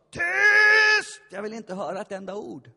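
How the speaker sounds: high, loud commanding shout; booming inner voice. Retold or imitated. Inner voice silencing the praying pastor, loud shout. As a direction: bark it down like a voice from within. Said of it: "den helige ande ... som sa".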